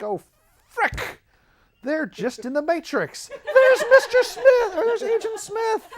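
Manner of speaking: a hammed-up fan spotting the same actor; mock shocked realization. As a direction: jump way up in pitch, slow and squealing on Mister Smith. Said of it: "and I'm like".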